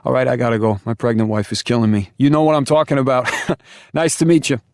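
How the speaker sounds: high, loud, hurried New York Italian goodbye; New York Italian accent. Acted. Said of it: "thick New York Italian accent".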